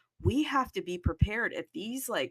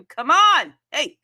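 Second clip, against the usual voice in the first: calling out; 5 semitones higher, 12 dB louder, slower than usual. Acted. Calling after a lost protocol.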